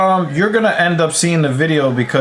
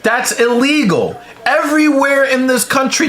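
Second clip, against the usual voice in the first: exasperated; 11 semitones higher than usual. Real, not acted. Outraged at campaign finance.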